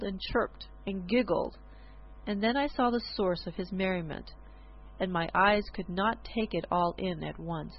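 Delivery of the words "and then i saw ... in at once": plain reading voice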